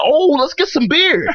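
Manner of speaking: put-on high, slow eager childish plea; excited kids. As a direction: whine for beer like a kid. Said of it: "They were like".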